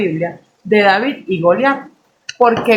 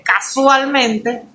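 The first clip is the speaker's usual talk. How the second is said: CASUALMENTE drawn out slowly and pitched up; ironic emphasis; 3 semitones higher, much slower than usual. Real, not acted. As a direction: stretch out every syllable of casualmente. Word repeated with ironic emphasis.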